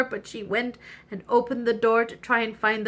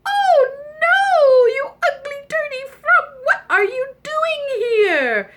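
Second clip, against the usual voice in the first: shrill, much higher, loud cry of disgust; disgusted shriek; 8 dB louder than usual. Acted. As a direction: shriek at the ugly frog, slowing on the question. Princess sees the frog.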